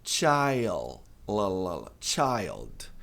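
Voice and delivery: put-on "Child" with the L sounded clearly; clear final L. Shown as a demonstration. Teacher stresses the L sound for students. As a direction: lean on the L of "child". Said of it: "tem que pronunciar o L".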